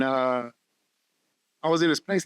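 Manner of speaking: ordinary talk, nothing marked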